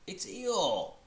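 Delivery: high, loud sudden surprise; surprised. Acted. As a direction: blurt the name in surprise. Surprise at Eeyore appearing.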